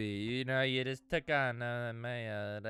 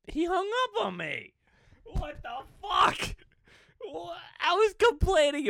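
a much higher, loud incredulous shout; shocked outburst; 7 dB louder than usual. Real, not acted. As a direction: yell in disbelief at being hung up on. Caller hung up on him.

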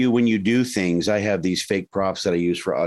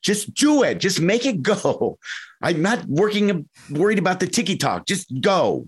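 impatient dad; 8 semitones higher than usual. Retold or imitated. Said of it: "I'm like".

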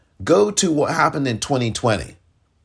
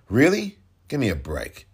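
ordinary talk, nothing marked

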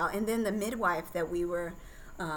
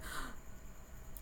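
sharp alarmed intake of breath; imitating the doula's startled gasp; mock gasp; 12 dB quieter than usual. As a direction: gasp in sudden panic. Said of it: "The first time that she was like".

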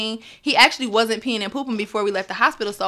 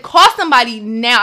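louder, firm, no room for argument; firm louder demand; 8 dB louder than usual. Retold or imitated. Mother insisting the nurse call for help, louder firm demand. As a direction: insist she calls for help right now.

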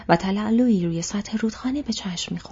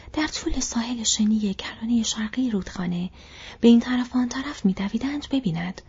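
usual speaking voice

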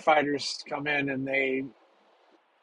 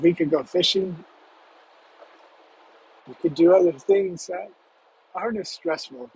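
higher-pitched, hopeful pleading; earnest pleading; 4 semitones higher than usual. Acted. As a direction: offer it as an earnest plea to your dad. Teen pleading with his sick dad.

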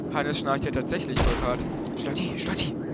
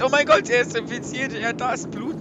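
mock panic; 8 semitones higher, 7 dB louder than usual. Fake panic about infection.

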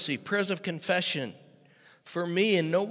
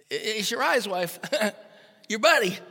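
laughing; 7 semitones higher, 6 dB louder than usual. Retold or imitated. Servant's quote through laughter.

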